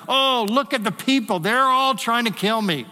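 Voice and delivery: high, whiny mock complaint; self-pity. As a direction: whine like a sulking prophet, pitch up. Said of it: "His self-pity".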